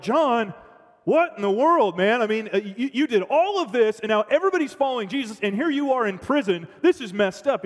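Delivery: buddies protesting. Pretend. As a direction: protest the unfairness, rising.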